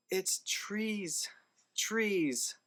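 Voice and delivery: high-pitched, incredulous emphasis on trees; exasperated disbelief. Real, not acted. Reviewer fed up with the movie's twist, high exasperated disbelief. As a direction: squeak out the absurd reveal in disbelief.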